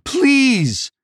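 loud, very high begging cry; high wail of pleading; desperate plea. A narrator's reading. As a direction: beg for your life. Wounded villain begging for his life.

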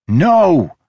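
loud, high furious shout. Acted. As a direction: scream it out. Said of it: "he shouted".

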